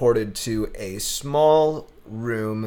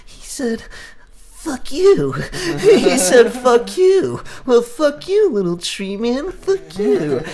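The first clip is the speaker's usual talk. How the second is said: very high, cackling creepy creature voice; hammed-up creepy cackling creature; creepy laughing voice; 13 semitones higher, 3 dB louder, slower than usual. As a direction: giggle it like a creepy Gollum thing. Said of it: "creepy ass voice laughing behind you".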